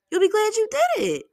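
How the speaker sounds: very high, loud cheerleading repeat; high-pitched encouragement. Real, not acted.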